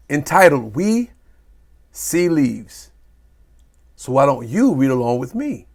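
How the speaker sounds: ordinary delivery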